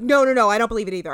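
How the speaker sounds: quick, loud mock denial; hasty backtracking. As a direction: rattle it off, imitating a hurried retreat. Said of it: "And Magdalena's like".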